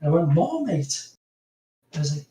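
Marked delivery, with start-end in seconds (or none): 0.3-1.1 high-pitched incredulous echo, retold; baffled disbelief. Guest recalling his answer to the Marmite plan, baffled. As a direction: query it as if you misheard.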